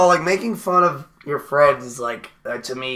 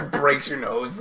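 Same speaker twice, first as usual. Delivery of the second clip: ordinary talk, nothing marked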